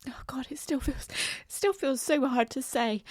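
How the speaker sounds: fighting back tears; choked up, trying not to cry; holding back tears. Real, not acted. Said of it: "I'm trying not to cry right now".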